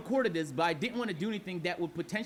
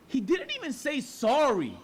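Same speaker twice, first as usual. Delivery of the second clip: indignant; 6 semitones higher, 4 dB louder than usual. Real, not acted. Indignant about no apology.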